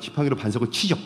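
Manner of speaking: ordinary talk, nothing marked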